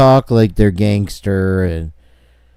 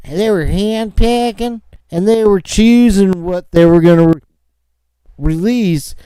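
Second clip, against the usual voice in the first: mocking emphasis; 8 semitones higher than usual. Real, not acted. Host ridicules police choosing which tapes to release, mocking. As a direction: pitch it way up and mock them.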